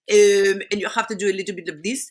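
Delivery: French accent with drawn-out hesitation hums; comic French accent, stalling. Shown as a demonstration. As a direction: play up French "euh" fillers, lingering on each. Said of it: "this is what the French do".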